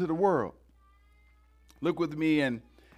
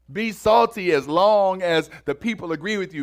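louder and higher, driving preacher cadence; full-force preaching; 5 semitones higher, 5 dB louder than usual. Acted. Preacher urging the congregation to act today, rousing sermon voice. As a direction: build the sermon to a rousing call.

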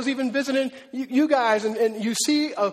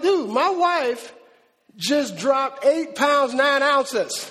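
slow, drawn-out amazement, a touch higher; awed boast; 3 semitones higher, 3 dB louder, much slower than usual. Retold or imitated. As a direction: stretch each word in disbelief. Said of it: "you're like".

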